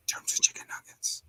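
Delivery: soft unvoiced whisper; eerie whispering voices. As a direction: hiss it like voices in his head. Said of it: "You hear the voices".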